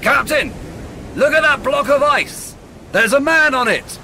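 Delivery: high, loud alarmed shout across the deck; excited sailor crying out to the captain about a man on the ice; sailor calling out. Acted. Sailor calls out spotting man.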